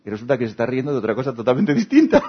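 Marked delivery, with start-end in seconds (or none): 1.3-2.3 laughing. Real, not acted. Comic reveal, laughing.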